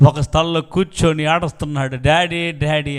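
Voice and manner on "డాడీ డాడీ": normal talking voice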